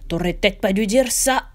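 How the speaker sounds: a different gruff sidekick voice; golem Hicham. A narrator's reading. Golem Hicham warning while running to narrator. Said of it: "dit Hicham en courant vers moi".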